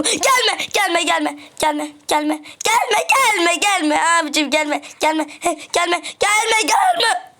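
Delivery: high pleading rising to a shout; panicked begging. Real, not acted. Gamer begging the enderman not to come, panicked to shouting. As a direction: plead frantically, ending in a yell.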